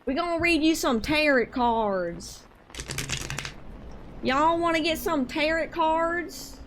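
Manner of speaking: slow, drawn-out Georgia accent. Shown as a demonstration. Streamer offering viewers a card reading, exaggerated Georgia accent. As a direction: stretch it out in a thick Georgia accent. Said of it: "I have a horrible accent".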